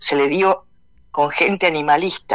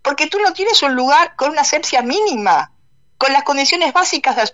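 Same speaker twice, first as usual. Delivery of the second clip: indignant; 9 semitones higher than usual. Real, not acted. Indignant rebuttal of interviewer.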